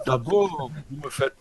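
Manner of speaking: laughing through the word. Real, not acted. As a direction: break into a laugh. Laughs at players' remarks.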